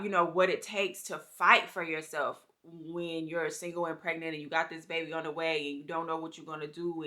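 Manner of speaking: normal talking voice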